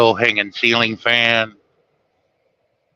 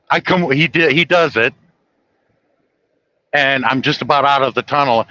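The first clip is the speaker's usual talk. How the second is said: ordinary talk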